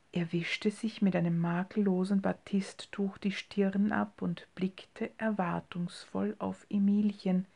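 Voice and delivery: usual speaking voice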